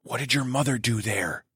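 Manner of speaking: soft, hushed question; whispered. A narrator's reading. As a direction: whisper it so the child can't hear. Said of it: "I whispered".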